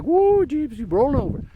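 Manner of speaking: startled high whoa; surprised whoa. Retold or imitated. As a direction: caught off guard as it tips. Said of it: "when I went like this, looked like".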